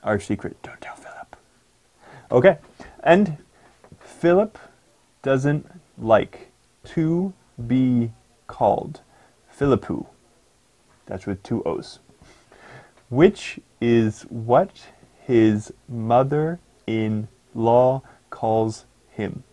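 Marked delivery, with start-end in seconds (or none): 0.0-1.4 playful secret whisper. Real, not acted. 4.0-19.4 slow, word by word, long pauses; read slowly. Shown as a demonstration. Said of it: "I'm going to read it a lot slower".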